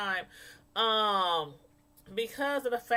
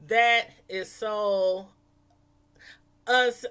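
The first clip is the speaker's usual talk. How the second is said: drawn out; 3 semitones lower, 4 dB louder, much slower than usual. Retold or imitated. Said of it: "I was like".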